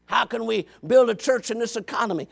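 ordinary delivery

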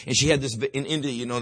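usual speaking voice

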